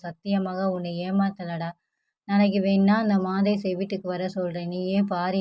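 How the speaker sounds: ordinary delivery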